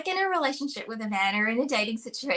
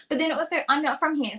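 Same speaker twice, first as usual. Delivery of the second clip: ordinary talk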